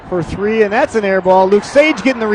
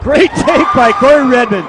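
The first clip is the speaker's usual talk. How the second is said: excited call; 5 semitones higher, 5 dB louder than usual. Real, not acted. Praising a play.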